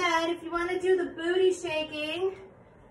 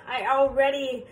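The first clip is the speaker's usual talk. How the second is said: ordinary talk, nothing marked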